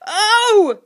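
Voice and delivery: loud, high groan; pained groan. A narrator's reading. Sniff elbowed in the belly, groaning. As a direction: groan out loud, pitch shooting up. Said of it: "groaned Sniff".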